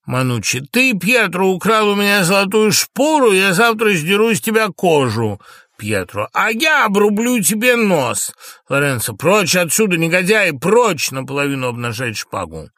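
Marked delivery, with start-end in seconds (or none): 0.6-5.4 high, loud accusation; heated, raised-voice quarrel; angry threat. Acted. Servants quarrel, threat of flaying. 6.3-8.3 high, loud counter-threat; heated shouted comeback; angry retort. Acted. Quarrelling servant threatens back. 9.1-11.1 loud, high command; shouted, outraged order; angry dismissal. Acted. Lorenzo drives servants off with sword.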